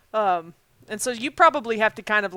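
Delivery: normal talking voice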